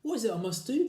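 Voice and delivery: high, loud desperate cry; crying wife. Acted. Wife crying to her husband, desperate. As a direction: beg for the cure. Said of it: "cried Missus Twit".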